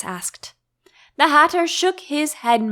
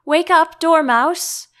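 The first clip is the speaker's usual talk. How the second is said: louder, higher wake-up call; loud call to a sleeping dormouse; 8 semitones higher, 6 dB louder, slower than usual. A narrator's reading. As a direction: shout to rouse the sleeper. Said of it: "they both cried".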